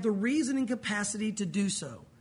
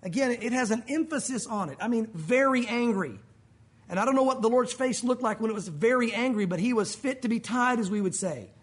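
ordinary delivery

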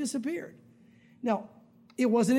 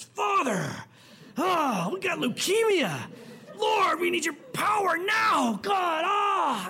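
desperate shouting prayer; 6 semitones higher, much slower than usual. Shown as a demonstration. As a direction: cry out to the Father as if everything depends on your volume. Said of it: "God has the hard miracles".

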